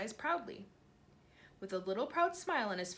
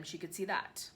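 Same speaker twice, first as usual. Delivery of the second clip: ordinary delivery, nothing marked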